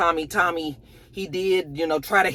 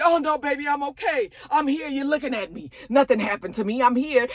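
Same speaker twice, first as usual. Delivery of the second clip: higher, lightly voiced soothing man; comforting man; 8 semitones higher than usual. Tommy reassuring Maria, higher soothing voice. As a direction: soothe her gently.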